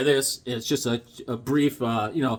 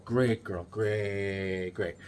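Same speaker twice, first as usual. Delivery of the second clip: softer repeat; 9 semitones lower, 9 dB quieter, much slower than usual. Real, not acted. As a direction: murmur it back to yourself.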